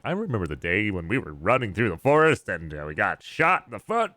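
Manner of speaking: sing-song mock reminiscence, pitch swooping up; hammed-up war-story voice, swinging high; put-on storyteller voice. Player fondly recalling a forest fight, in hammed-up voice. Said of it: "That's not really how we talk".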